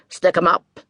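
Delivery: loud barked police order; cop's shout. Acted. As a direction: yell it like a cop drawing his gun. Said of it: "the cop shouted".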